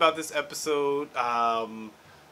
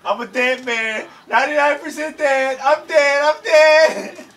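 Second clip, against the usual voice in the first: loud, high mock-hysterical wail; panicked wailing; 10 semitones higher, 9 dB louder than usual. Coward declaring himself doomed, mock-panicked shouting. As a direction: build to a frantic, hammed-up scream. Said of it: "I've never seen someone so panicked".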